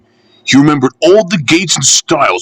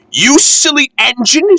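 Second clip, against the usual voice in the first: much higher lady's voice, teasing; a higher refreshment lady laughing at the engine; amused refreshment lady. Acted. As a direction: tease the engine fondly.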